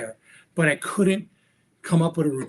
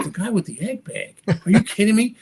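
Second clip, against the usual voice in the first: higher, disbelieving echo as a question; incredulous; 5 semitones higher than usual. Retold or imitated. Magician reacting to friend pointing out his idol, incredulous. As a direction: repeat it back in raised-pitch disbelief.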